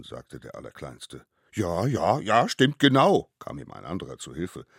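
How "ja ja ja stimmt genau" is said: put-on loud, climbing childlike agreement; eager little accomplice. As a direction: jump in loudly to back the excuse. Another child rushes to help. (eifrig zustimmendes Kind, laut)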